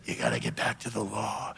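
a murmured mock rebuke, low in the throat; mocking the Judaizers. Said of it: "The Judaizers was creeping into the church saying".

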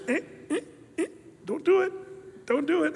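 tiny, high, soft warning voice; still small voice. Acted. Preacher as Holy Spirit tapping your shoulder, small high voice. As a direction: squeak a little nudge of conscience. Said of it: "that's the still small voice".

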